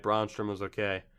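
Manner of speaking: ordinary talk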